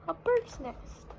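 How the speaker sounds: plain reading voice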